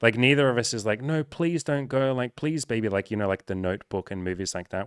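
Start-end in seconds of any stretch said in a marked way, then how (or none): 1.0-2.9 higher, drawn-out imitation of begging; mock pleading. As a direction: plead like a parody of a movie breakup. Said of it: "there's just so much pain".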